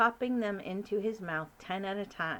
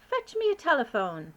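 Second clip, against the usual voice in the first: higher, louder royal command; commanding queen; 7 semitones higher, 7 dB louder than usual. Acted. Queen ordering the butler, commanding. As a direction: give a brisk order. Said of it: "the Queen commanded".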